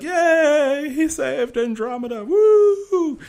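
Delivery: high, squealing mock cheer of a crowd. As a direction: cheer like a giddy crowd greeting the hero.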